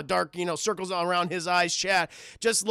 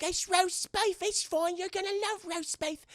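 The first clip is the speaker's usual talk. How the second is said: put-on high, softer child voice; little girl coaxing; 9 semitones higher, 4 dB quieter than usual. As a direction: sweetly coax a pet to eat, as a child. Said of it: "She's like".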